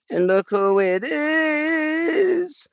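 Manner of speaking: very high, slow, cooing pet voice. Real, not acted. Greeting his cat at home, high slow cooing baby talk. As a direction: coo it slowly to a beloved cat.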